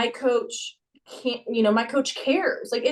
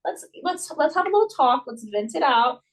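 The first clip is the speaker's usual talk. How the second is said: soothing coach; 7 semitones higher than usual. Retold or imitated. Coach calming sad gymnast, gentle higher voice. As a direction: coax her kindly, pitched up.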